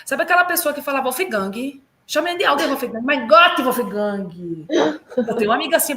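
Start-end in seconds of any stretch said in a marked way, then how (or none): 1.0-4.7 mocking Brazilian-accented German, extra vowels and sing-song pitch; heavy Brazilian accent. Imitating the person who says Wolfgang uncorrected, thick Brazilian accent. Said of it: "mas o seu sotaque".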